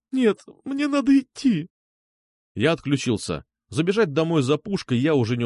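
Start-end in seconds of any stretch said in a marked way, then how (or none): 0.1-1.7 slow, very high woman's voice; distressed refusal. A narrator's reading. Алёна insists on going to Виктор, distressed.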